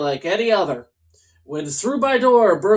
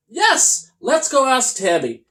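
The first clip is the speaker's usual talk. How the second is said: high, louder eager shout; Toby shouting; 7 semitones higher, 4 dB louder than usual. Acted. Unicorn shouting to go ask Tammy. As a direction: cheer the idea out loud. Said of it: "Toby shouted".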